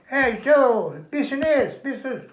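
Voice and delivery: mock French peddler hollering; calling Frenchman. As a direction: call out like a street seller.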